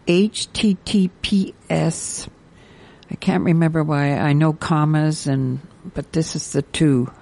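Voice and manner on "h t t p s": slow, measured letter-by-letter read; very slow spelling. Real, not acted. Radio host repeating the site for listeners, very slowly. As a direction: spell it out so it can be written down. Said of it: "gonna say this very slowly".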